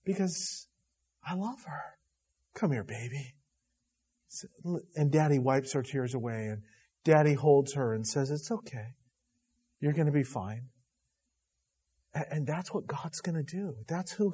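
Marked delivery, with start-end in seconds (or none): 0.1-3.3 put-on soft hushed daddy voice to a crying child; tender comforting. Father comforting crying daughter. 8.3-10.7 put-on soft hushed daddy voice to a crying child; tender comforting. Father soothing crying daughter.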